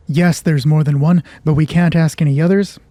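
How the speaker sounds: usual speaking voice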